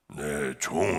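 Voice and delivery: low, slow, solemn divine voice; a calm deep call from above, very slowly; voice of the Lord. Retold or imitated. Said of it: "주님의 음성은".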